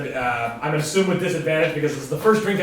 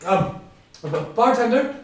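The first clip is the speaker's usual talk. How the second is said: higher, hesitant call for the bartender; calling a second time to a bartender who has his back turned, timid and higher; 6 semitones higher than usual. Acted. Calling again, ignored by bartender.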